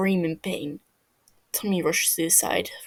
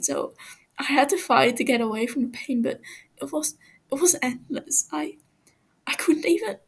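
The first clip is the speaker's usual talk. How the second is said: higher, whispering, breaking into a broken laugh; haunted whispered recollection; 6 semitones higher than usual. Acted. Captive Fofo describes dying over and over, whispering and breaking. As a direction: relive the horror, voice cracking.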